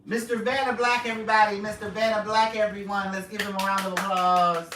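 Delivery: loud, high game-show announcer voice. Pretend. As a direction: introduce the guest with fanfare. Announcing a guest to the audience.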